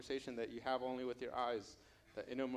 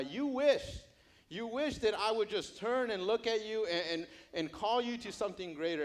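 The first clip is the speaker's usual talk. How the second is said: raised, higher preaching voice; impassioned appeal; 7 semitones higher, 5 dB louder than usual. Acted. Jesus reminding Matthew of being called, impassioned. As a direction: press it home with rising fervor.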